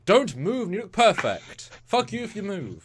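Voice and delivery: high, mocking voice of a stiff uniform; mock uniform talking. Speaker voicing her uniform, mock snarl. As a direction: sneer it like a bossy uniform.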